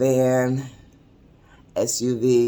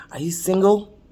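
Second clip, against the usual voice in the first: higher-pitched acted question; lightly voiced elderly suitor asking; imitated suitor's question; 6 semitones higher than usual. Elderly gentleman asking her if she's single, mildly acted.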